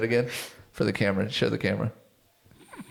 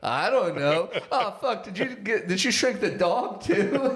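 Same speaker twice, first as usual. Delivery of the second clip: high-pitched skit voices, amused; painting character voice; 8 semitones higher than usual. Acted. Podcaster acts out the shrink ray argument, painting character voice. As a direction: play a high-voiced back-and-forth between figures. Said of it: "they all have voices".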